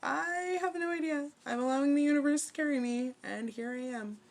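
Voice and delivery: high, airy sing-out of helpless surrender; mock cluelessness. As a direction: pitch it way up, blithely giving in. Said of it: "And you're just like".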